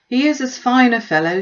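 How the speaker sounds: a hint of dry mock praise; lightly voiced Mr Bennet. A narrator's reading. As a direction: sardonic father praising falsely.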